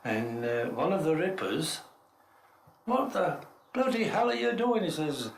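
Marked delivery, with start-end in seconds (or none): 2.9-4.8 high-pitched telling-off; retold exasperated demand, pitched up; imitated indignant challenge. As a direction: act out an impatient workmate. Ripper challenging him over the delay.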